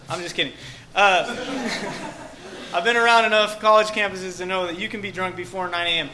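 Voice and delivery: ordinary delivery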